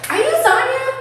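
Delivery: put-on high chirpy young woman voice; a bubbly new hire's greeting; perky new girl. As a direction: squeak it like an eager newcomer.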